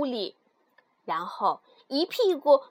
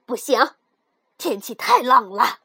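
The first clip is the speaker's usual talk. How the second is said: loud, lower-voiced refusal; firm stepmother; 3 semitones lower, 7 dB louder than usual. Stepmother refuses her daughter the trip, firm and loud. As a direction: refuse firmly as the mother.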